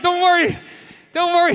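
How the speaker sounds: high, pained, insisting he's fine; strained tough-guy voice. Retold or imitated. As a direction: grit through the pain. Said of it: "He's like".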